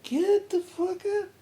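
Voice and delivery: shooting way up high and slow; slow high mock shock; stunned disbelief. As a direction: squeal it in total disbelief. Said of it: "And I was like".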